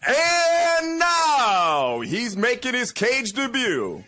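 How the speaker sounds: announcer call. Shown as a demonstration. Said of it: "you say what I tell you".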